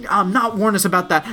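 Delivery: high, quick, stammering mock outrage; imitated indignant tirade; mock yelling. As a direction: hammer out the accusation, imitating a scolder. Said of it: "comes out there and yells at him".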